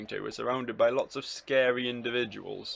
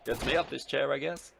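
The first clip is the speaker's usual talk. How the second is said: a hint of annoying poshness, pitched up; slightly posh, annoying; 3 semitones higher than usual. Samba leaving her chair, lightly posh and annoying. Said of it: "I forgot Samba's voice ... slightly posh".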